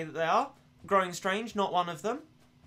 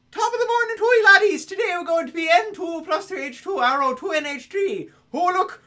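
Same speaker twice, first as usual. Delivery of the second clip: hammed-up Irish commentator, pitched way up; Irish accent; 10 semitones higher, 10 dB louder than usual. Shown as a demonstration. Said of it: "is an Irish accent".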